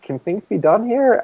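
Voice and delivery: high, slow, leading question; tentative mock request. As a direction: float the question up slowly, as if sounding someone out. Acting out how he'd ask.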